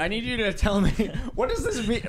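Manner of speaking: high, pressing mock questioning; mock interrogation. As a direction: demand an answer, playfully serious. Mock-serious questioning of Mike.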